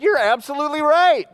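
bright, high, loud and drawn-out yes; beaming, slow, emphatic admission; cheerful agreement. Retold or imitated. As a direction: happily own up to it with a grin. Pastor cheerfully agrees with accuser.

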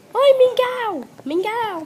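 high, loud call to the cat; cheery loud hello to the cat. Real, not acted. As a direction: call out to the pet across the room. Calling the cat over.

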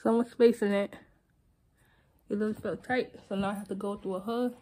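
fingers at teeth while talking; slight lisp from tray; speaking with aligner in. Real, not acted. Seen 0: Fingers at teeth pulling aligner out until 2.4; held in hand later.

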